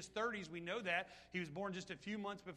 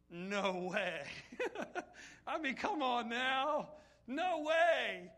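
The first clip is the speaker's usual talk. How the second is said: louder, laughing no way; laughing disbelief; 3 semitones higher, 5 dB louder, much slower than usual. Real, not acted. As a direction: marvel through a laugh, loud. Preacher marvels at raised man.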